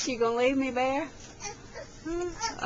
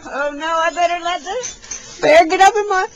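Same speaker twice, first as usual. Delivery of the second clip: high, loud pretend panic; fake-alarmed about the bear taking the chair; mock alarm; 7 semitones higher, 8 dB louder than usual. To the toddler bear taking her chair, loud mock alarm.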